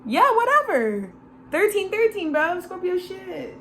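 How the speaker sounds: high, breezy brush-off; mock dismissive. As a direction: toss it off lightly.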